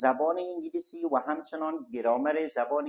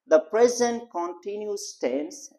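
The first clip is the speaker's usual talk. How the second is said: slow dictation; 4 semitones higher, much slower than usual. Shown as a demonstration. Teacher dictates English definition.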